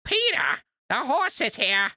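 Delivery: high, slow cartoon-wife impression; Lois voice. Streamer tries a viewer's requested character, high Lois impression. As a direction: put on a high character voice, drawn out. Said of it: "Do my best Lois voice".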